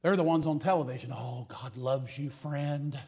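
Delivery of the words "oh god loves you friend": slow, soft, syrupy televangelist plea; mocking a smooth TV preacher asking for money, softer and slow. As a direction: coo the offering pitch like a TV preacher. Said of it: "they just know how to talk like this".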